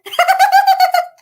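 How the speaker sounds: very high loud shriek of laughter. Real, not acted. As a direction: explode into a squeal. Laughing hard.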